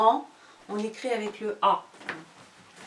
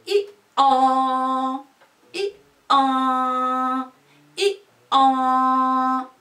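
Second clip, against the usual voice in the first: i an sung out louder and higher; donkey bray; 4 semitones higher, 8 dB louder, much slower than usual. Shown as a demonstration. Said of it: "Tu fais".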